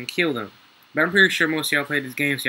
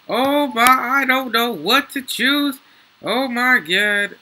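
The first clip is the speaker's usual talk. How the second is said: high, loud and slow with amazement; real awe, pitched up and slowed; excited disbelief; 8 semitones higher, 5 dB louder, slower than usual. Real, not acted. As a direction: drag it out, thrilled. Overwhelmed by choice of pickups.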